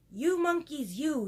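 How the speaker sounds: high, loud peddler's rebuke; scolding. A narrator's reading. Peddler shaking a finger at monkeys, scolding. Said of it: "shaking a finger at them".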